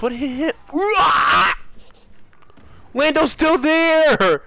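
high panicked shouting; panicked yelling. Real, not acted. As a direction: yell in alarm as a teammate falls. Teammate dropped, panic.

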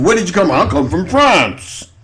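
usual speaking voice

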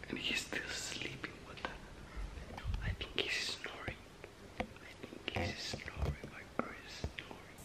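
hushed whisper in the dark; whispering while hiding. Real, not acted. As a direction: keep it an unvoiced whisper. Hiding, friend sleeping nearby.

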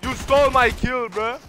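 exasperated gamer yell; indignant outrage. Real, not acted. Angry at stolen kill.

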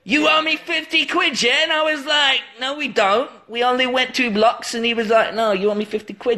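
high, excited mock London voice; London Cockney accent. Shown as a demonstration. Retelling a row with a cab driver, high London accent. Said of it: "'Cause that's what you do in London".

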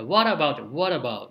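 what about with the T flapped to a light ラ, "wara-bout"; what about, T turned into a quick ラ sound. Shown as a demonstration. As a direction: say what about as "warabau", tongue tapping just behind the teeth. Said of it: "ちょっと前にするような感じのラ行".

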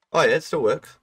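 happy little exclaim; pleased relief. Real, not acted. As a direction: cheer that it still works. Relief that the build works.

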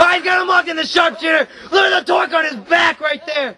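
high-pitched shouted play-by-play; excited call. Real, not acted. Commentator seeing the sharpshooter locked in, excited shout. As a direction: shout the hold as it locks in. Commentator calls submission hold.

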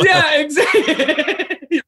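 slow laughing yeah. Real, not acted. Agreeing with co-host, laughing through it. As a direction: laugh the words out, high and slow.

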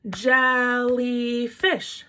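very slow jel-ly-fish, each beat apart; syllable clapping. Shown as a demonstration. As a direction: stretch jellyfish into three claps. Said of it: "now let's count how many times we clapped".

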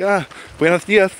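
high, lisped Galician Spanish greeting; imitating the Galician lisp; Galician Spanish accent. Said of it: "I acclimate".

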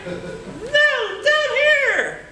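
high, cartoonish little monkey voice; talking monkey. Caged monkey begging the boy to free him, high voice. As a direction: squeak it out like a tiny caged monkey.

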